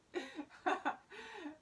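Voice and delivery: high-pitched burst of laughter. Real, not acted. Teacher caught out on mute, high laugh. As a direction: laugh at your own slip. Laughs at having been muted.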